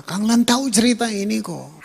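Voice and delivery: ordinary delivery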